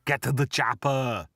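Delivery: put-on lightly done Austrian Arnie accent; a hint of Arnold's Austrian accent; Arnie impression. Host quoting Predator, light Arnie Austrian accent. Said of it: "I like doing my Arnie impressions".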